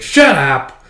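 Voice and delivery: loud acted snap; irritated retort. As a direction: cut them off with a burst of volume.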